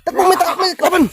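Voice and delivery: high, urgent calls to run; urgent excited shout. Real, not acted. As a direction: yell at them to run. Urging teammates to run in game.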